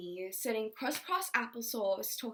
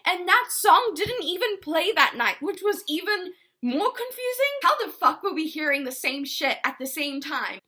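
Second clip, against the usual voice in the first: bewildered excitement; 9 semitones higher, 9 dB louder than usual. Real, not acted. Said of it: "confused retelling".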